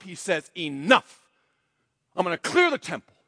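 high, loud fiery sermon delivery; full force preaching. Acted. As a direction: preach it out to the whole church, building.

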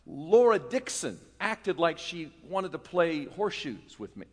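ordinary delivery

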